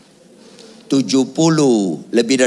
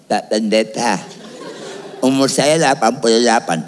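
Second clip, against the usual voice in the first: put-on elderly pastor. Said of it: "ada pendeta pensiun emeritus".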